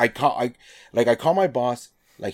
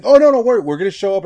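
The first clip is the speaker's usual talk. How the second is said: high, loud burst of reassurance, then settling; boss brushing it off; 6 semitones higher, 7 dB louder, faster than usual. Retold or imitated. Boss on the phone brushing off his worry, high and loud. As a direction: wave away his worries like a breezy boss.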